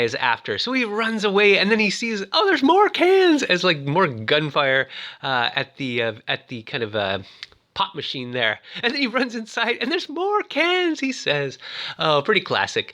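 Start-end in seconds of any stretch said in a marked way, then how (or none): 2.3-3.4 high, loud mock-panicked cry; mock alarm. Retelling Navin fleeing the gunfire, mock alarm. 9.9-11.0 high, slow mock wail; mock alarm. Retelling Navin running inside, mock alarm.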